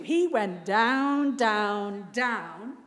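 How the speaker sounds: "down, down, down" slowly stretched, each repeat held long; drawn-out emphasis. A narrator's reading. Preacher tells the congregation of Jonah sinking, drawn-out emphasis.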